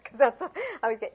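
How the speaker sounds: ordinary talk, nothing marked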